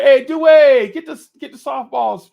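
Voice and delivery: loud high call across a softball field. Retold or imitated. Man yells to Dewey to fetch foul balls, loud call.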